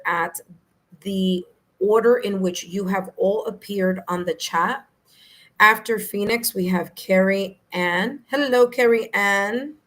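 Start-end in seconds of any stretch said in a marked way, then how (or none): none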